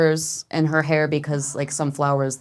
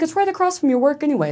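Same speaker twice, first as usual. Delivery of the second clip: high, quick sales pitch; breezy persuading; 11 semitones higher, faster than usual. Retold or imitated. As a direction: imitate a wife brushing off objections. Wife arguing for moving in.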